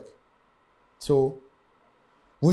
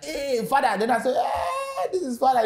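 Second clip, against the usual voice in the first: excited shout; 12 semitones higher than usual. Retold or imitated. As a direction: call out over the crowd, thrilled.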